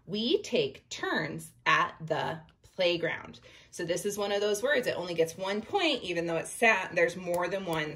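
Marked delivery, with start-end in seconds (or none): 0.0-3.4 slow, steady read with each word separated. Shown as a demonstration. Teacher rereading the line so each word gets one point, slow. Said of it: "And as I'm talking".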